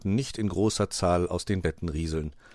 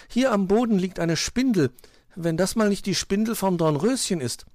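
high, loud little bird voice; little bird calling; 12 semitones higher, 5 dB louder than usual. A narrator's reading. Zwitschi shows friends a find, calling out high. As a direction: chirp for everyone's attention.

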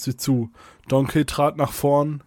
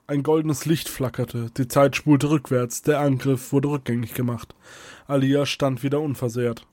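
usual speaking voice

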